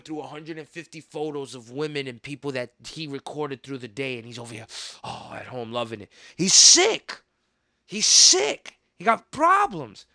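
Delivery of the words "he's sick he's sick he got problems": much louder and higher, slowing at the end; outraged outburst. Real, not acted. Condemning the man who films women, loud disgusted outburst. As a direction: shout your disgust at him.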